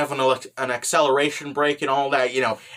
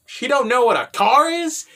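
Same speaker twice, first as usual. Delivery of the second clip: higher incredulous question; mock disbelief; 7 semitones higher, 3 dB louder than usual. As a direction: act out being baffled by the question. Acting out the girls' disbelief.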